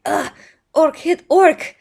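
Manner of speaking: loud, high, slow caveman-style orc voice; indignant orc. Acted. Orc struck by his fellow orc, loud mock outrage. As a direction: ham up a wounded orc, drawn out and loud. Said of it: "And that orc is like".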